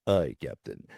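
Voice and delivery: low, resonant helmsman's reply; George Takei. Retold or imitated. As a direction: drop into a deep resonant voice. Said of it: "great low resonant voice".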